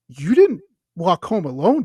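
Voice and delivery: loud, slow disbelieving question; retold alarmed hotel clerk; imitated scolding concern. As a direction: check on someone in mock alarm. Hotel lady alarmed at lone walker.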